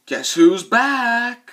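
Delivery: slow, loud drawn-out movie hero line; badass one-liner. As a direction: growl it out like a cheesy action hero. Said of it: "badass one-liners like".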